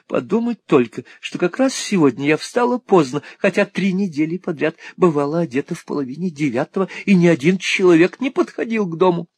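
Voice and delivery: high-voiced Miss La Creevy lamenting; a flustered old maid grumbling at her luck; fussy little spinster. A narrator's reading. (суетливая старая дева, высокий голос)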